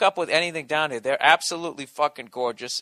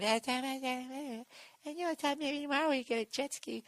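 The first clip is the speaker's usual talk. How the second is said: put-on much higher, much softer girlfriend's voice, drifting away; girlfriend's voice fading off; 11 semitones higher, 9 dB quieter than usual. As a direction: chirp it sweetly as if from far off. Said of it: "my girl's voice just fades off".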